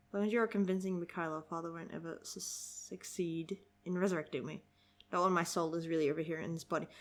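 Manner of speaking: soft, low, quick read-aloud; reading dialogue. Acted. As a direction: read it quietly and matter-of-factly.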